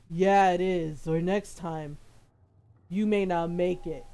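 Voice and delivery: very low mock man's voice; booming deep voice. Kid threatening his rival, in a put-on booming bass. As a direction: imitate a full-grown man's boom, dropped right down. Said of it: "this booming voice".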